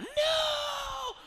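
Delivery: very high, loud cry of no; panicked shout. Retold or imitated. Peter freaking out as Jesus loses the crowd, screamed. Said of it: "And Peter's freaking out".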